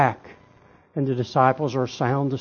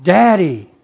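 loud, raised cry for a father; 5 semitones higher, 9 dB louder than usual. Acted. Preacher repeats Jesus' cry to his Father, loud crying out. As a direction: call out to Daddy in anguish. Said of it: "And he cries out".

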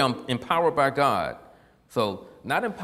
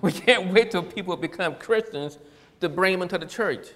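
louder, higher, run together without long pauses; emphatic repeat; 4 semitones higher, 4 dB louder than usual. Real, not acted. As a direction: drive the point home forcefully. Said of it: "Do I need to say that again?".

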